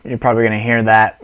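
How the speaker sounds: normal talking voice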